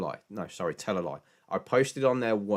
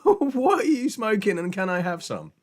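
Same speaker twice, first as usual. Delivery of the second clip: laughing disbelief; 13 semitones higher, 7 dB louder than usual. Retold or imitated. Teasing himself about the method, laughing. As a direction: laugh out the question.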